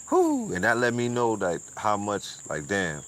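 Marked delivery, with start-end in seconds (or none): 0.1-0.5 very high whew of amazement; amazed whew. Real, not acted. Streamer reacts to the pastor's words with a high whew.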